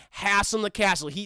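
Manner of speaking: ordinary talk, nothing marked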